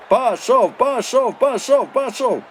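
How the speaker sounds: high, loud desperate screech; piercing shriek. A narrator's reading. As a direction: shriek at the player to run. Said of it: "верещав таким отчайдушно страшним голосом". (пронизливий відчайдушний вереск)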